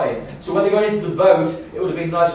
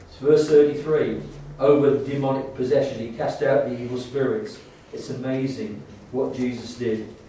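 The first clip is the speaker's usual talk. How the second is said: ordinary talk, nothing marked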